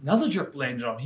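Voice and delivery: ordinary delivery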